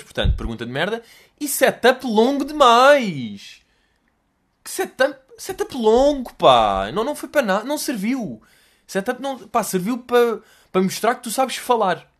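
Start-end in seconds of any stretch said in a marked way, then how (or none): 1.4-12.1 higher, louder, dragged-out outrage; exasperated rant. Real, not acted. Comedian ranting loudly at the host's long setup. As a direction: vent at the pointless setup.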